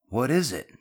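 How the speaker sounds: concerned call. A narrator's reading. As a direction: call out with a touch of worry. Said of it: "Drew called out, concerned".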